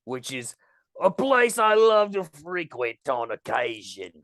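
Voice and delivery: low put-on Cockney; hammed-up, weird Cockney celebrity guest. Guest asking to be interviewed, in a low fake Cockney. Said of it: "Cockney accent".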